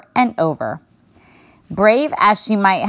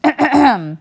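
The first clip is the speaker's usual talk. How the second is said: put-on a small girl clearing her throat for attention; a forced throat clear to be noticed; loud throat clearing; 8 semitones higher than usual. A narrator's reading. Girl clears throat to get bear's attention, loudly. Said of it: "She cleared her throat as loudly as she could".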